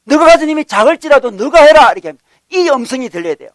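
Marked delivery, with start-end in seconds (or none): 0.0-1.9 raised preacher's voice for God; commanding. Retold or imitated. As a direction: urge it as a divine order. God's command voiced in sermon.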